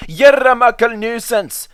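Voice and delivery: high, put-on Scottish engine voice; scolding the van in a broad Scottish brogue; Scottish engine Donald. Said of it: "said Donald one day".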